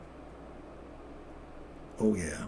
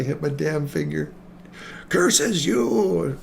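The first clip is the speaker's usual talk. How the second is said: hammed-up cartoon villain, higher on "Curses you"; mock villain curse; 4 semitones higher, slower than usual. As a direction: shake a fist at the chart like a silly baddie. Cursing the market, cartoon villain.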